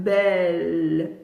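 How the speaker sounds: "Belle" low, with a lengthened L; "Belle" drawn out, dropping in pitch; syllable and a half. Shown as a demonstration. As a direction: let the L of "Belle" linger after the vowel. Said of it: "C'est une syllabe et demie".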